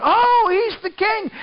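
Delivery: high, slow gasp of wonder; mock crowd marveling at the king; awed onlookers.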